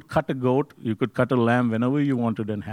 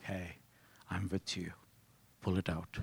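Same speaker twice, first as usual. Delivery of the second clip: gentle voice of God; 6 semitones lower, 17 dB quieter than usual. Retold or imitated.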